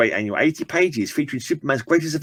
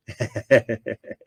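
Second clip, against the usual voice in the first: short laugh; 3 dB quieter than usual. Real, not acted. Laughs after own accent.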